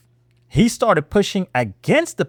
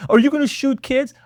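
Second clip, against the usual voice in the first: lightly voiced mocking politician, raised high; mock quote; 8 semitones higher than usual. Vlogger mocking Maxine Waters' line, high mocking voice. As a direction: parrot her line with a scornful lilt. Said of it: "coming out of the ringleader's mouth".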